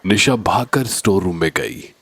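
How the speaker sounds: ordinary delivery, nothing marked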